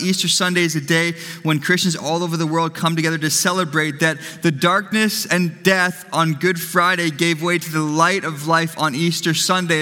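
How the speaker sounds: ordinary talk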